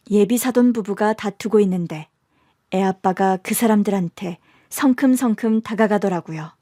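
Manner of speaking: ordinary talk, nothing marked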